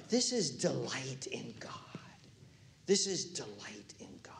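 hushed, breathy-free whisper of awe. Real, not acted. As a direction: drop to a whisper to let it land. Preacher whispers key line.

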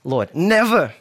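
loud, high outburst of refusal; emphatic rebuke. Acted. Peter rebuking Jesus over his predicted death, emphatic protest. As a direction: forbid it with an exclamation mark. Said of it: "began to rebuke him".

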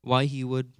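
ordinary talk

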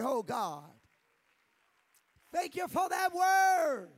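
loud, high praise shouts; fervent praise. Real, not acted. Worship leader calling the congregation to stand, loud praise. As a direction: lift the room in praise.

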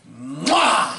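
kiss sound. Real, not acted. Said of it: "and he was doing kissing".

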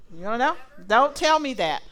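loud mock complaint; play-protest at the timekeeper; joking protest. Real, not acted. Preacher told time is unknown, loud joking protest.

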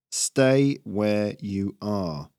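very slow, deliberate order; commanding. Acted. Squirrel raider holding a crowd in place, slow command. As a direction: stretch each word out as a command.

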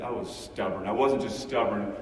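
ordinary talk, nothing marked

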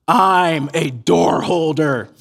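very slow, drawn-out announcement; measured, weighty mock-proud delivery; proud reveal. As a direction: imitate a kid solemnly revealing his big part. Boy's proud reveal of role.

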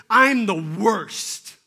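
mock despair. Self-pity rant mocked.

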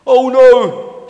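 high, very loud cry of dismay; alarmed shout. Acted. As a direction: shout it in sudden panic. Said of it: "shouted Stefan".

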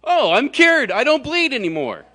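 high, loud, hammed-up startled cry; overacted gasp of relief; mock startled cure. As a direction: play the woman jolted and suddenly healed. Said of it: "she's supposed to go".

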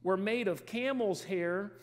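slow, deliberate Bible reading; scripture reading. Acted. Preacher reading John's description to church, slow and deliberate. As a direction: lay out each detail slowly for the congregation.

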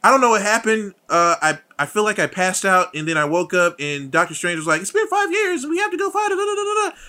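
high, loud babble climbing into a squeak; excited babbling imitation. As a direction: mimic a flustered recap, pitch shooting up. Retells a character babbling excitedly.

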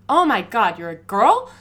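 loud, startled cry of disbelief; surprised shout. Retold or imitated. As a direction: bark it out, shocked she's a girl. Cop surprised she is a girl.

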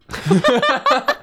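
high squealing laugh. Real, not acted. Laughs after fake sobbing.

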